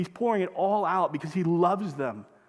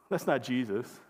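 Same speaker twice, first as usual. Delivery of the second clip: usual speaking voice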